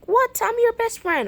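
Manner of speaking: high, shocked squeal; indignant protest. Acted. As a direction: protest in hurt disbelief. Hurt protest to hated-you remark.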